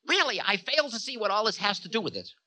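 high, fast, impatient voice; Time, dismissive. A narrator's reading. Time failing to see Ravana's point, dismissive. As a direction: brush it off quickly, pitched high.

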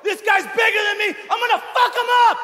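very high, strained shouting; hammed-up battle cry, rushing at the end; crazed fighter's yell. Acts his crazed yell in the ring.

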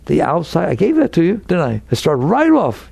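ordinary talk, nothing marked

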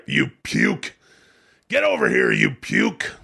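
lightly voiced barking drill sergeant; Sergeant Slaughter. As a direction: bark it like a gruff drill instructor. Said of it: "That's what he used to say".

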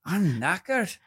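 high-pitched British attempt slipping into Irish; Irish-sounding accent. Shown as a demonstration. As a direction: try British but land in Irish. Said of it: "You see, that's Irish".

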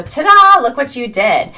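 high, loud cheer; celebratory ta-da. Acted. Coach cheering the listener's wins along the way, triumphant. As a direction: present it with a flourish.

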